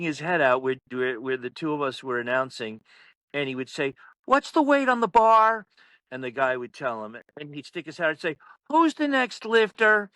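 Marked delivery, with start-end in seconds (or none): 4.2-5.7 higher, louder mock demand; pushy coach voice, pitched up; pestering coach. Coach pestering the announcers, retold. 8.7-10.1 higher, louder mock demand; pushy coach voice, pitched up; pestering coach. Coach pestering the announcers, retold.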